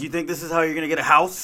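mock celebrity chef tirade; mock Gordon Ramsay rant. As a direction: berate like an angry TV chef. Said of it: "He's like".

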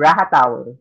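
"Raha Tower" with an H sound; J pronounced as H. Retold or imitated. Passenger repeating condo to puzzled driver, "Raha Tower" with H. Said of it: "you pronounce J as H".

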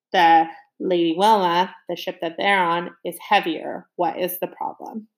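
ordinary delivery, nothing marked

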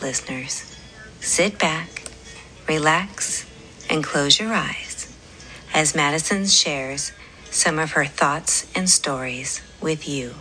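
usual speaking voice

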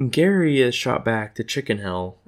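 usual speaking voice

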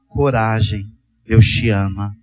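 low, gentle reassuring voice; tender encouragement. Shown as a demonstration. Models an encouraging word to someone.

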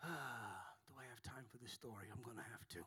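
muttered under breath; whispered aside. Real, not acted. Preacher mutters about time under his breath. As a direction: whisper it to yourself.

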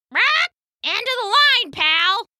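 high mock gangster hen; menacing hen. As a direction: threaten like a bully bird.

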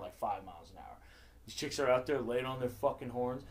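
ordinary talk

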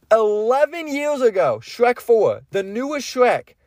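high-pitched, incredulous repeat; can't believe it's been that long. Real, not acted. Astonished at how old it is.

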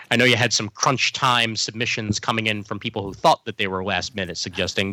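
usual speaking voice

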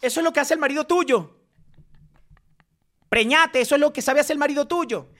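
high-pitched fast tirade; heated ranting. Real, not acted. Lashing out about the other's husband, high and scornful. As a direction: scold without a break, voice pitched right up.

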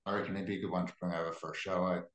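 usual speaking voice

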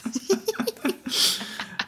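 high breathy laugh. Real, not acted. Laughing at hangover story.

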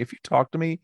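high-pitched exasperated brush-off; mock indignation. Diner shooing talkers away during the volcano onion, mock-indignant. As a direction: voice shoots up, shooing someone away.

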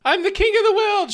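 high, loud laughing yell; laughing shout. Acted. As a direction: shout it grandly through laughter. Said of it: "shouts Jafar".

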